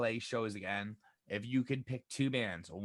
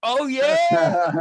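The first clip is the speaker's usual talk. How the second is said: much higher and louder, whooping; excited cheer; 16 dB louder than usual. Real, not acted. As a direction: shout it out like a cheering fan. Cheering after band question.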